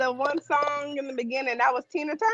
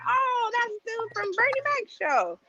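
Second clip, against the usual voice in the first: high quoted voice; 12 semitones higher than usual. Retold or imitated. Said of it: "I was like".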